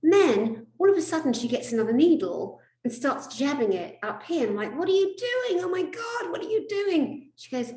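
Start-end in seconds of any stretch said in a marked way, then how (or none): 4.8-7.3 high-pitched alarmed protest; hammed-up shrill panic; retold panic. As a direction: squeal in horror at a surprise needle. Retells panic at unexpected needle.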